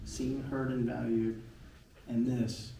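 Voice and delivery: ordinary delivery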